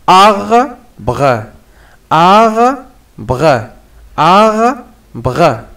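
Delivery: "Arbre" broken as "ar... bre", long first syllable; split into syllables. Shown as a demonstration. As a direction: sound out "arbre" in two slow beats, pause before "bre". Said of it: "هاد الكلمة نقطعوها على شكل لي سيلاب". (« arbre » découpé en syllabes : « ar… bre »)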